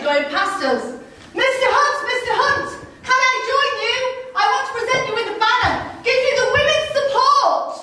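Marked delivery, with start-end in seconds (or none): 1.3-7.7 calling out. Acted. Woman hails passing carriage.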